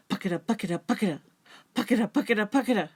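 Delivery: rhythmic breathy running chant; running panting sound. Acted. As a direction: pant it out like a running possum. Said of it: "this is how he sounded when he ran".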